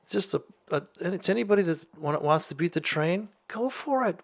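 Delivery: ordinary talk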